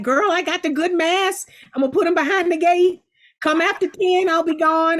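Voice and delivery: high, loud impression of a friend on the phone; exaggerated, high girlfriend-to-girlfriend impression; Octavia Spencer. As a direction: call out in a big, high-pitched friend voice. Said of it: "that was my impression of Octavia doing herself".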